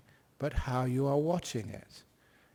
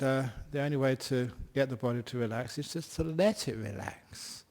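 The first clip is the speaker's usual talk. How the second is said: normal talking voice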